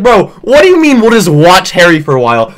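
loud, indignant outburst; triggered. Retold or imitated. Reacting to a commenter's slight, triggered. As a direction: protest it in mock outrage. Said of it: "I was so triggered".

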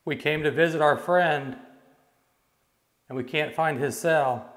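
loud, carrying calls into the dark; calling out. Real, not acted. Ghost hunter calling to spirits in the prison, loud. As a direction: project it down the empty cellblock.

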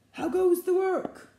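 high, loud farmer's call; the farmer calling to the duck. A narrator's reading. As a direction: shout it from the bed. Farmer's voice in retelling.

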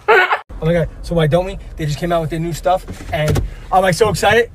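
fast, loud gushing; loud excitement. Acted. Teen telling mom about the new stuff, loud and excited. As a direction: burst with excitement.

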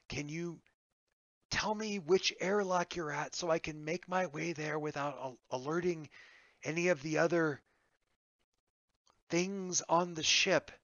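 hushed, hesitant man in hiding; hiding survivor, cautious. Acted.

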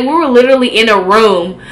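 ordinary talk, nothing marked

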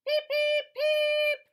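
very high, loud little beeps; squeaky duckling peeps. Acted. Reading to a child, duckling peeping squeakily. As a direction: peep like a tiny duckling.

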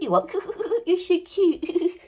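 ordinary delivery

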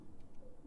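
a short, faint, airy gasp; startled gasp. Real, not acted. Gamer gets hit by a mob, faint startled gasp. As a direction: gasp when the attack hits. Gasps after an attack.

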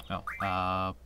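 ordinary talk, nothing marked

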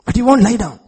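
sudden high, loud outburst; exasperated shout. Acted. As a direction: burst out loudly in exasperation. God's complaint, suddenly shouted.

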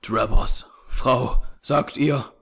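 slow, stunned, halting question; breaking voice. A narrator's reading. Jefferson learning Lucy is married, voice breaking. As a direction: falter with understated shock. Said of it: "stammelte er mit brechender Stimme".

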